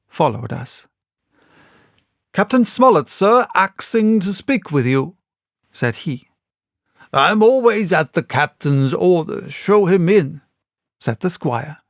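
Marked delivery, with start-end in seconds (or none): 2.3-5.1 raised, higher sailor voice; sailor announcing. A narrator's reading. Sailor announcing the captain to the squire, raised and higher. 7.1-10.4 higher, bluff squire voice; booming host at the door; hearty squire. A narrator's reading. Squire inviting the captain in, bluff and higher.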